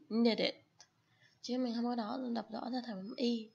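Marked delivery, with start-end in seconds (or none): 0.1-0.5 "Knit it" with the ending as a short vowel, not a full "it"; knit it linked. Shown as a demonstration. A Vietnamese teacher models "Knit it" for her student, linked and short. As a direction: run "Knit it" together, the last vowel short and slightly off. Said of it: "Nó sẽ hơi chệch miệng một tí".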